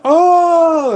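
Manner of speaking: sharp high startled oh; surprised oh. Retold or imitated. Host reacting to a shocking interview answer, surprised. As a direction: gasp it out, caught off guard. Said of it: "John Oliver said".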